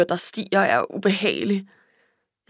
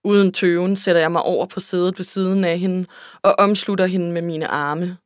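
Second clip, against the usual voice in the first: usual speaking voice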